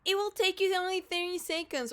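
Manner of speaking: high, fast, sneering mimicry of a pushy creep; high mocking singsong; mocking quote. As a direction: parody the pushy message.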